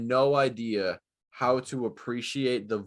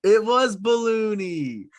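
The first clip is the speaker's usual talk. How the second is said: excited recall; 11 semitones higher, 6 dB louder than usual. Real, not acted.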